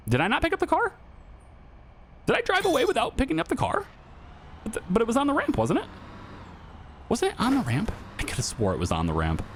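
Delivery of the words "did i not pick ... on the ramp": much higher, baffled questions to himself; startled disbelief. Real, not acted. Driver realizing the car is missing, rising baffled disbelief. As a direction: suddenly realize you left the car behind.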